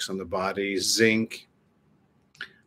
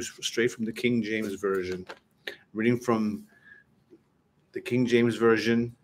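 normal talking voice

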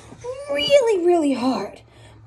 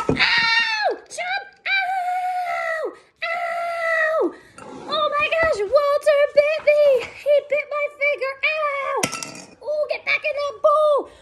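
pained yelps; 11 semitones higher than usual. Acted. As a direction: yelp high after a finger bite. Bitten by goldfish.

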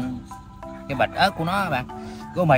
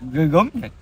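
louder, strained by the heat; mouth burning from spicy food; 4 semitones higher, 5 dB louder, slower than usual. Real, not acted. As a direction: push it out through the spice burn. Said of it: "Thật sự nó cay".

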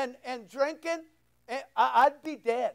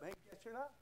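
hushed retort; 4 semitones lower, 25 dB quieter, much faster than usual. Retold or imitated. Hushed retort to underdressed man.

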